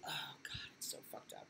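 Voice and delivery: breathy whispered aside, almost to herself. Real, not acted. Streamer reacting to the flood news in a hushed whisper. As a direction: drop to a whisper, shaken by the news.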